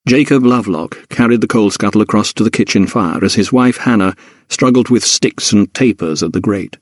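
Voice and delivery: ordinary delivery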